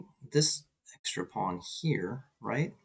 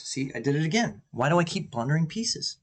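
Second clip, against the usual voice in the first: higher, louder frustrated complaint; exasperated self-reproach; 6 semitones higher, 4 dB louder than usual. Real, not acted. Player who just blundered a piece, exasperated. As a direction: scold yourself in rising exasperation.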